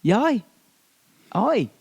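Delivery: very high, badly faked Australian; failed Australian accent. Host testing the accent they can't do, high mock Australian. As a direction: have a poor go at Australian. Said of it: "I can't do".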